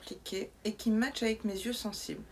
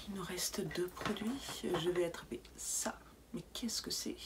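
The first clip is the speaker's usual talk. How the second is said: whisper; 3 semitones lower, 6 dB quieter than usual. Real, not acted. Whispered unboxing.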